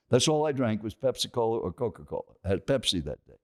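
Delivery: normal talking voice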